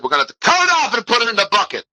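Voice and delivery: high, rushed doctor's order; urgent quote. Acted. Doctor orders the coach's toe off, urgent. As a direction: blurt it out fast and high.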